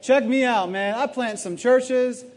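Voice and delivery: lightly hammed-up swagger; preacher mocks an imagined bragging Paul, in a mildly cocky voice; mock boastful Paul. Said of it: "for Paul to be like".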